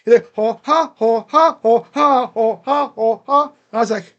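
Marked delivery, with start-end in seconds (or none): 0.4-3.4 loud rhythmic ho-ha siren imitation; quoting a cartoon's siren gag, louder; mock siren moan. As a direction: belt out a silly siren like Family Guy's Peter. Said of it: "your sirens sound like three people having a threesome".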